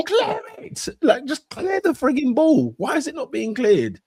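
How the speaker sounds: high-pitched mock yell at the TV; strained, high exasperated plea at the screen; retold screaming. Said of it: "Screaming at the screen".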